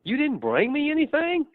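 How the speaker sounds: high, whiny mock pout to a spouse; whiny complaint. Spouse complains about a trip with no gift, in a high whiny pout. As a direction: act the sulky spouse back from a trip. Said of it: "and you say".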